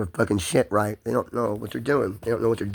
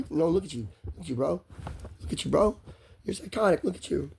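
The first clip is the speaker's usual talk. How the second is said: softer imitation of a cop; dismissive cop; 5 semitones higher, 6 dB quieter than usual. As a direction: lightly mimic a cop dismissing someone, voice dropping softer. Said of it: "the cop won't give me that space".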